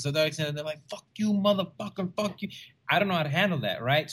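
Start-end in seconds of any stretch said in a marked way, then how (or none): 0.9-2.5 high-pitched imitation of hecklers cussing; mock angry cussing. Angry audience members cuss at the comic, high mock anger. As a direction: curse someone out in a high mock voice. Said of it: "they start cussing at me".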